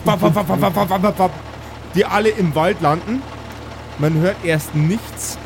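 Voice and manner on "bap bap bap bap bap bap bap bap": rapid mock machine-gun bursts; gunfire noises. A narrator's reading. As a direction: spit out each shot like a salvo. GM imitates machine gun salvo.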